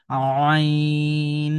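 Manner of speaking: আইন held long, pressed from mid-throat; Arabic throat letter, pressed; pressed throat ain. Shown as a demonstration. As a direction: squeeze আইন out of the throat, sustained. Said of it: "এই যে একটু চাপ দিচ্ছি".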